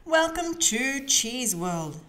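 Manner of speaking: drawn-out, cheerful announcer welcome; announcing. A narrator's reading. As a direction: greet the visitors like a storybook host. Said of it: "announced Miss Rabbit".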